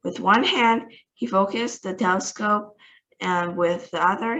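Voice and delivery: ordinary delivery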